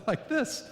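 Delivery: a quick laughing squeal of surprise; imitating a startled kid. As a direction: act out the shocked child, high and breathless. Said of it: "the kid immediately is like".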